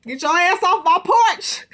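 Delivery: loud, high mock shout. Recapper mimicking a woman ordering people off her porch, hammed-up shout. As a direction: holler it like an imitation, high and full-voiced.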